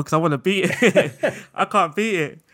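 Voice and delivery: laughing. Real, not acted. Laughs at unbeaten video.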